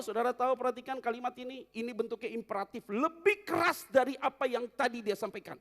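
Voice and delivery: loud, high preacher's call; fiery preaching. Acted. Pastor explaining the verse to the congregation, fervent. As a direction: drive the point home.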